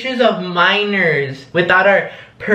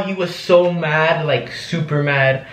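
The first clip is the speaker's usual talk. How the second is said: plain reading voice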